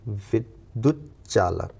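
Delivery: विद्युत चालक very slow, with a half चा then a full चा; slow careful pronunciation. Shown as a demonstration. Teacher to students, slowly spelling विद्युत चालक aloud. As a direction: sound out चालक syllable by syllable. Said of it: "यानी आधा चा, then पूरा चा".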